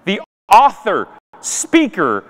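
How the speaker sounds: usual speaking voice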